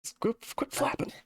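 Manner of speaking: Matthias complaining on his way downstairs; annoyed Matthias. Acted. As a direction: shoo away a flapping bird, fed up. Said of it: "Matthias's voice. He's like".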